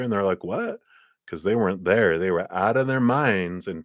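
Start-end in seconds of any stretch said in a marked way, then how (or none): 0.4-0.8 high, squeaky what of confusion; hammed-up clueless squeak; mock baffled. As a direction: play dumb with a high what. Swimmer doesn't understand.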